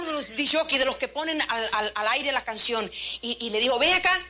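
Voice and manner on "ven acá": calling over. Retold or imitated. Manager calls disc jockey over.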